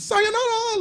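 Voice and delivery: very high cartoon squeak; imitating a high cartoon farewell; high-pitched anime voice. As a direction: chirp it way up like an anime girl. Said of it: "like this, like, a high pitch".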